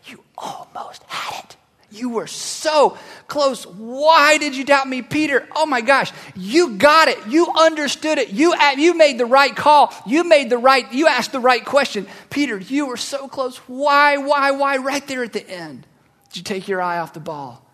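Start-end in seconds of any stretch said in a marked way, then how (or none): 0.1-1.6 slow, hushed whisper. Retold or imitated. Jesus softly comforts Peter after he sinks, whispering. 1.9-14.8 higher, slower, fond coaching voice; encouraging Jesus. Retold or imitated. Jesus lifting Peter from the water, fondly coaxing.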